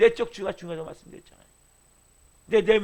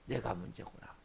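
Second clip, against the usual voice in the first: hushed realization; 11 semitones lower, 16 dB quieter than usual. Retold or imitated. Sinner's hushed self-realization.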